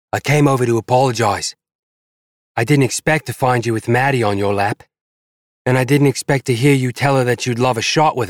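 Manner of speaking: hurt angry woman. Acted. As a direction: press him, wounded and angry.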